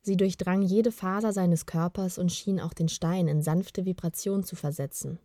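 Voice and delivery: usual speaking voice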